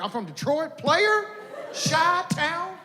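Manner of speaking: high, hyped tough-guy boast. Retold or imitated. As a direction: puff up like a street tough. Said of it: "They're like".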